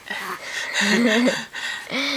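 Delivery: high bubbly laugh; delighted laugh. Real, not acted. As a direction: burst out laughing. Mom laughing at baby.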